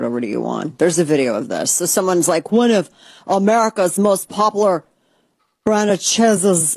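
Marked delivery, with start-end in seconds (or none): 2.5-6.8 mock announcer. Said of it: "I don't know why I'm talking like this".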